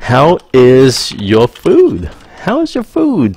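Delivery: slow, then squeaky high repeat; mock waiter voice. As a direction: play a chirpy waiter. Waiter checking on table.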